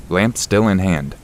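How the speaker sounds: ordinary delivery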